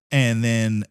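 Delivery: ordinary talk, nothing marked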